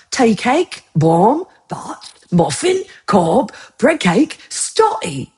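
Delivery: slow, exaggerated British accent with pitch swoops; attempted British accent. Shown as a demonstration. Streamer trying to say bread-roll names right, attempted British accent. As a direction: put on a British accent, word by word. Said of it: "Let me try to say it right".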